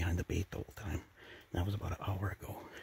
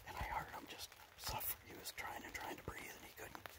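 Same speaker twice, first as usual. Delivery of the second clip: hushed, unvoiced-sounding whisper from the blind; excited news kept to a whisper; hunter's whisper; 7 semitones lower, 9 dB quieter than usual. Real, not acted. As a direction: whisper low so the animals don't hear.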